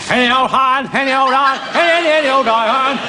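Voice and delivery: rag-and-bone man's call; drawn-out sing-song street cry. Acted. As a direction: bellow it like a street trader. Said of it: "volunteer to be one more voice tester".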